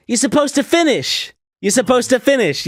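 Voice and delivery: high, pestering demand; imitated insistence. Woman demanding he finish her line, high mock insistence. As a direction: press him in her high put-on voice.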